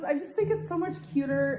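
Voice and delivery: normal talking voice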